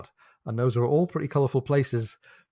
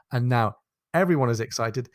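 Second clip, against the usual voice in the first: usual speaking voice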